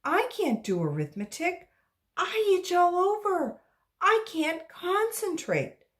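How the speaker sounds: put-on high, whining child's voice; a kid pleading out of class work; whiny child. Child whining to her teacher, high and whiny.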